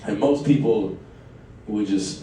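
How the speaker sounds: usual speaking voice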